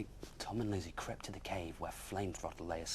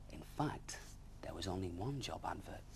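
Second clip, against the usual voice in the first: usual speaking voice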